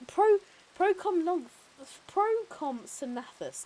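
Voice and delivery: slow, high, drawn-out dinosaur name; laboured high-pitched pronunciation; slowly sounded out. Shown as a demonstration. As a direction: stretch out each part of the awkward name. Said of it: "you have to say it slowly".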